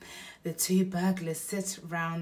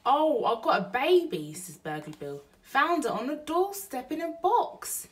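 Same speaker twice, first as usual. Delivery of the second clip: Burglar Bill; 8 semitones higher, 7 dB louder than usual. Acted. Bill's voice in the story.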